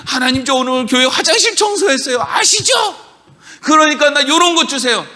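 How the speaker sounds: high put-on voice of a boastful believer, 아시죠 squeaking up; mock whiny prayer. Pastor mocks a believer bragging to God, high and whiny. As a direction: parody a child begging for a reward.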